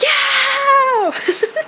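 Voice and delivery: high joyful laugh-yell; giddy laughing shout. Real, not acted. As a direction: burst out laughing in triumph. Celebrating reaching the goal.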